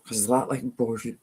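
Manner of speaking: normal talking voice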